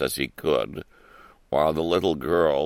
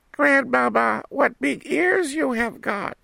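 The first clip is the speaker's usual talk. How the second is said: put-on little girl voice. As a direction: ask like an astonished small girl. Girl questions.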